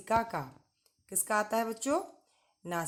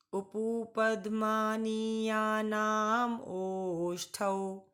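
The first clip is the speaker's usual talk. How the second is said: slow sutra recitation, ओष्ठौ drawn out long; recite along; slower than usual. Shown as a demonstration. Teacher leads students in reciting the sutra slowly. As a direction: say it slowly so the class can repeat. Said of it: "मेरे साथ बोलिए बच्चों".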